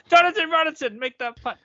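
high, loud cheering golf fan; drunk and fired up. Acted. Fan urging his golfer to make that putt, drunk and fired up. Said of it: "when I'm all drunk and I'm fired up".

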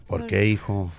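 father. Acted. Vater antwortet dem Sohn.

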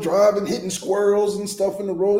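ordinary delivery

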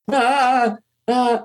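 high, nervous stammered ahs; starstruck stammer. Retold or imitated. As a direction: gasp out two flustered ahs.

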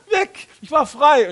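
very high, laughing exclamation; joyful. Retold or imitated. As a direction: let it burst out laughing and free. Joyful release after the jump. (jubelnd und lachend, sehr hoch)

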